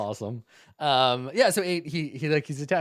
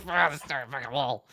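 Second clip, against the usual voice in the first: higher-pitched, jokey frustrated grumble; mock exasperation; 3 semitones higher than usual. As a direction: curse the wall in playful annoyance. Mocks player pawing at wall.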